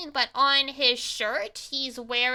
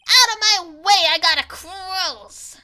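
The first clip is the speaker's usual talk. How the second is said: loud, raised, hammed-up bluster; brusque shouted order; 6 semitones higher, 6 dB louder than usual. Impostor clearing his way out, loud hammy bluster. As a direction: push past them with a raised shout. Impostor shoving past to leave.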